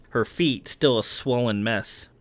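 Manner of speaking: ordinary delivery, nothing marked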